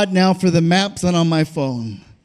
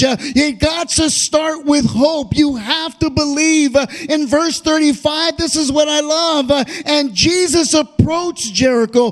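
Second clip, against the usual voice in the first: fervent, raised preaching cadence; preaching at full force; 6 semitones higher than usual. Acted. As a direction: preach it out with a hoarse-free swell. Preacher building fervor.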